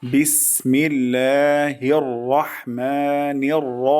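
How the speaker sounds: slow, melodic recitation voice. Shown as a demonstration. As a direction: recite with tajweed, drawn out. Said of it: "কখনো রা হবে না". (তেলাওয়াতের সুরে)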